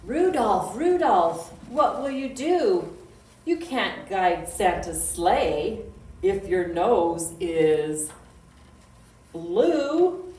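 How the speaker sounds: loud, sing-song poem reading; children's poem recital. Acted. Librarian reciting a reindeer poem to kids, loud sing-song. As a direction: chant the rhyme out for little listeners.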